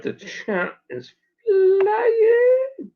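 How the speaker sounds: "flying" drawn out long and very high; excited, slow-motion high wail; amazed exclamation. Real, not acted. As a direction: stretch the last word into a high, sustained squeal. Amazed at the racing chat.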